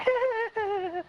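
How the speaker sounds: high-pitched imitated cackle; mocking sister's laugh. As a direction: mimic a taunting little laugh up high. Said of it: "starts laughing at me".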